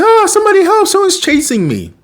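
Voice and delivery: shrill mock terror, calling out; panicked cry for help. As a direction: yelp for help while being chased. Ben fleeing into supermarket.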